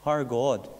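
ordinary talk

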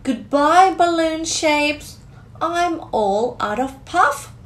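ordinary delivery, nothing marked

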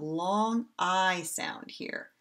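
long I sound drawn out slowly; slow down and stretch long I sound here. Shown as a demonstration. Teacher stretching long I for kids. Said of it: "you listen for that".

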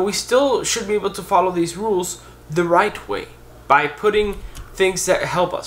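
ordinary delivery

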